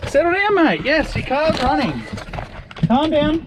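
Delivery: high-pitched talk to a dog; calming excited dog. Real, not acted. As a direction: tell an overexcited dog to settle, pitched high.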